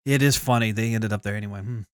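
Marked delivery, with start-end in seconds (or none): none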